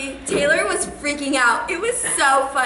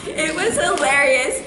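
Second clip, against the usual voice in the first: ordinary delivery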